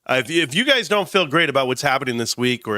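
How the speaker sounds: ordinary talk, nothing marked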